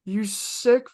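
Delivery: high, slow disgusted cry of oh you sick; disgusted retort. Retold or imitated. Coworker to creepy customer, slow high disgusted retort. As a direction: spit it out in revulsion, drawn out.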